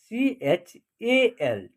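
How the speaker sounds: सीएचएएल spelled out very slowly, letter by letter; slow letter spelling. Shown as a demonstration. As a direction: draw out सीएचएएल before reading the word. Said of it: "चल".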